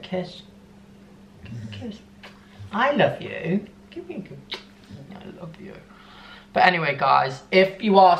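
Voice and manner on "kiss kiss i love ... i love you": soft, much quieter pet talk; cooing to the dog. Real, not acted. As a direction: whisper your love to the dog.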